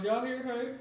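ordinary talk

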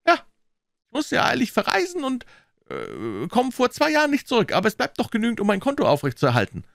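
high-pitched, casual excuse; lightly voiced businessman, pitched well up; hurried banker. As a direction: explain away the withdrawal with a brief hesitation.

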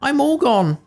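very high squeaky final lament; tiny cookie's last little words; gingerbread man voice. Acted. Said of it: "said the gingerbread man".

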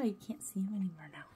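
fast soft whisper. Real, not acted. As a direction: whisper so he won't hear. Whisper about hidden pet.

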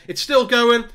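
sudden loud, high burst; excited call. Real, not acted. Gamer calling his match live, excited yell. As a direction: yell it as the ball stays alive.

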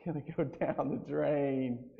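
chuckling the words out; preacher laughing as he repeats his own punchline. Real, not acted. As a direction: laugh through the punchline again.

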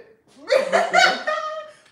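loud, high screaming laugh; loud laughing fit. Real, not acted. Laughing hard while quoting her friend. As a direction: lose it laughing.

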